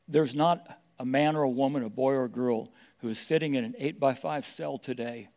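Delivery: ordinary talk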